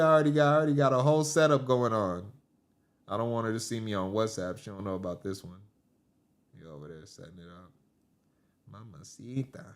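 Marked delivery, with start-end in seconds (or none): 3.1-9.6 very soft, sneaky undertone; playing a cheater murmuring to his phone; secretive husband. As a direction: keep it hushed so the wife won't hear. Acts husband hiding phone from wife.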